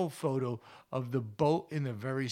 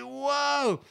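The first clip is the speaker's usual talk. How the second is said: put-on high, awestruck kid's whoa; imitated amazement; 11 semitones higher, 4 dB louder than usual. Host imitates children as the sun rises, amazed. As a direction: mimic a child's wonder, pitched way up. Imitates kids seeing the sunrise.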